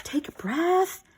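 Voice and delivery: drawn out, soothing, slowed right down; slow calming voice. Real, not acted. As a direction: breathe it out slowly like calming yourself. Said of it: "I'm gonna slow down, take a breath".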